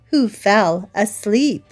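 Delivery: plain reading voice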